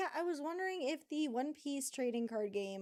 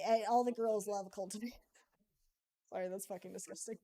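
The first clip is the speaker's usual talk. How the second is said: ordinary delivery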